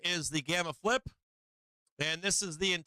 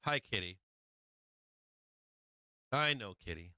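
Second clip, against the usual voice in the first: lower, softer, reassuring to the kitty; calm the cat down quietly; soothing the cat; 8 semitones lower, 4 dB quieter than usual. Real, not acted. Soothing his annoyed cat.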